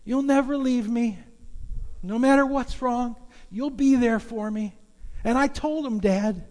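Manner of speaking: put-on high, earnest child's voice; little boy voice. Trapped son telling his father he kept faith, high child voice. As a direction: speak as a trusting little boy. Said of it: "And the little boy says".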